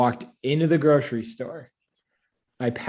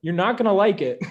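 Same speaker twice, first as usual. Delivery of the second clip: high, louder nervous warning; 8 semitones higher, 5 dB louder than usual. Retold or imitated. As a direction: warn him, already dreading it. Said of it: "And I was like".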